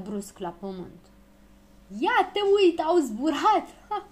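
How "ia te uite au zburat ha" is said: high, loud mocking laugh-through line; bursting into laughter. A narrator's reading. Fărâmiță jeers from the fence at the failed takeoff, bursting into laughter. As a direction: crow it out, laughing. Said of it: "Izbucni în râs".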